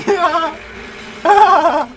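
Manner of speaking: high burst of giddy laughter. Real, not acted. Gamer amused that Metroids pose no harm, giddy laughing.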